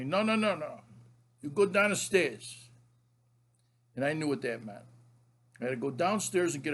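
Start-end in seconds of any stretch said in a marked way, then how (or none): 0.0-2.7 grandpa impression. Grandfather sends grandson downstairs instead, hammed-up grandpa voice. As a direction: imitate grandpa overruling the kid. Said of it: "my grandfather would tell me".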